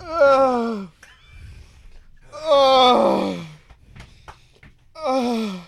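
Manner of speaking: pained groan. Real, not acted. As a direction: groan through the heat. Groaning from pepper burn.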